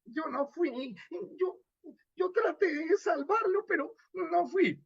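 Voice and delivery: high, crying, shrunken plea; a small frightened voice pleading through tears; cowering character. Shown as a demonstration. Radio teacher demos a diminished character, crying high pleas. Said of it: "esmirriado y disminuido".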